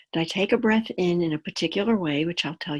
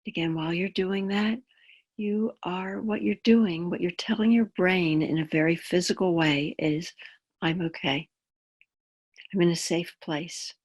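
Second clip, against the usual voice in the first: calm grounding exercise; 5 dB quieter than usual. Acted. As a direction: guide them gently into the body.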